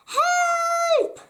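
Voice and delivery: very high, loud shout; panicked puppet scream for help; cry for help. Acted. Pinocchio calls for help.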